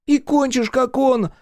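high-pitched, mocking interjection; cutting in. A narrator's reading. Friend interrupts the dreamer, cutting in. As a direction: jump in with a high jeer. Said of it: "перебил Улюлюля Дрыстун".

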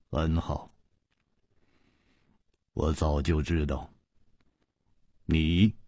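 dry chuckle, then low, halting words; dry-laughing villain. A narrator's reading. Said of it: "发出了一声干涩的笑，说". (干笑一声，低沉地缓缓说)

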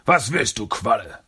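loud, irritated villain retort; villain snapping at his nagging partner; irritated Shredder. Acted. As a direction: brush him off, annoyed.

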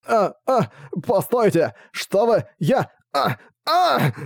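high frightened yelps; panicked protest. Acted. King being arrested.

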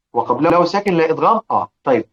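ordinary talk, nothing marked

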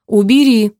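loud, firm order; forceful command. A narrator's reading. Radov orders brother to let go, loud firm command.

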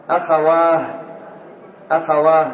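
"أخواه" ending in breathed h, akhawaah; final t as h. Shown as a demonstration. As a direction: stop on "أخواه" with long aa and h, no t. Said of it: "من يقف بالهاء".

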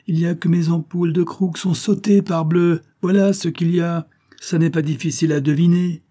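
irritated outburst. Acted. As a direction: bite back at the silly question. Physicist snaps after failed experiment.

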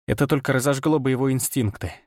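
usual speaking voice

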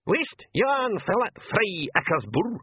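high, slow pixie interjection; small creature's high-pitched protest. A narrator's reading. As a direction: pipe up in thick dialect. Blue pixie interjects in dialect.

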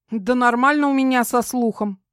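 high, irritated retort; snapping back. A narrator's reading. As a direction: fire back, offended. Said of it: "огрызнулась Кара".

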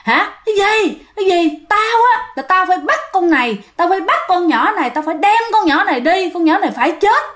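possessed mother. Retold or imitated. Possessed mother threatening to take the girl, high menacing yell. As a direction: shriek it like a spirit claiming its victim. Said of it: "mẹ la lên, mẹ gào lên".

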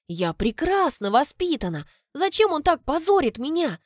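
plain reading voice

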